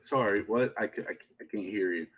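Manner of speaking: soft attempt at a British accent; Daniel Craig. Host imagines Daniel Craig as Batman, quiet British accent. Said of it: "he'd try to talk with the accent".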